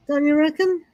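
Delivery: ordinary delivery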